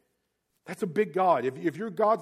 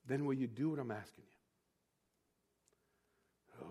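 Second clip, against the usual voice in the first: soft fatherly question; 7 semitones lower, 12 dB quieter, faster than usual. Retold or imitated. Father asks son softly.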